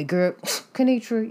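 ordinary talk, nothing marked

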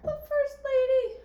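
very high, loud, slow squeal; squealed reaction to the answer; incredulous outburst. Real, not acted. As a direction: exclaim it in disbelief. Guesser exclaims at revealed answer.

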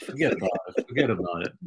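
put-on a light New York accent, playfully repeated. [name] playing along with cohost's joke, light New York accent. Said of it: "sometimes I slide into my New York accent".

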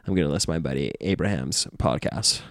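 low, swooping surfer-dude voice; hammed-up low surfer impression; mock California surfer kid. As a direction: imitate a surfer buddy, pitch dipping and bobbing. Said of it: "And he talks like this".

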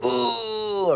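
high grunt through clenched teeth; gritted teeth grunt. Shown as a demonstration. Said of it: "you put your teeth together and you go".

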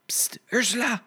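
high, hushed pst to a friend; hissed call. Acted. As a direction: tram hissing to wake sleeping Ursula. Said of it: "Blauwe Katrientje riep".

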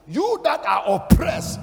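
sudden loud, high cry to the crowd; preacher's shout. Real, not acted. Preacher calling out to the oppressed in the crowd, shouting. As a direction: hurl it out over the room.

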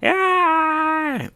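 very high held shriek, softened; long imitated scream; mock scream. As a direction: wail in cartoon shock.

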